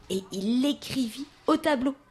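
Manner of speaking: plain reading voice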